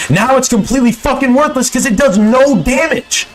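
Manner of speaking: high exasperated outburst; wild unhinged ranting. Real, not acted. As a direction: blow up in frustration. Angry rant about nerf.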